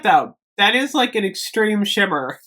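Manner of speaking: ordinary talk, nothing marked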